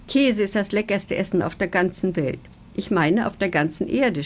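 ordinary delivery

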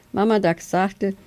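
ordinary delivery

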